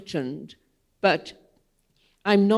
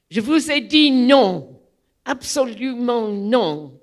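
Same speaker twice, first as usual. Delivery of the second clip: higher and louder mock shouted refusal; imitating the angry doorman shouting no; 4 semitones higher, 6 dB louder than usual. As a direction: bark back a flat French no. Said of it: "he shouted at me".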